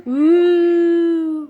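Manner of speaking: long, loud playful moo; mom's hammed-up moo; loud cow moo. Shown as a demonstration. Mom models cow sound loudly.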